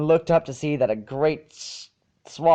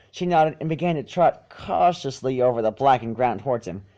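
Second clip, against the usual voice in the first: ordinary delivery, nothing marked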